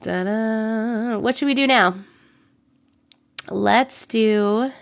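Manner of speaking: ordinary delivery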